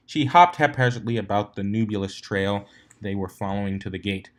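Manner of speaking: plain reading voice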